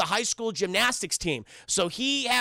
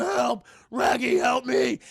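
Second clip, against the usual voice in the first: hammed-up cartoon dog crying for help; Scooby-Doo; 3 dB louder, slower than usual. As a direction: call out as a panicked talking dog. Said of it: "Scooby's like".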